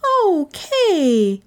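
put-on high, drawn-out girl's reproach; dismayed cry. A narrator's reading. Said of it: "Gentian cried".

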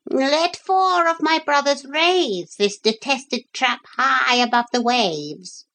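high, declaiming parrot voice; parrot giving orders. Acted. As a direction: command grandly in a high bird voice. Parrot gives the gulls orders.

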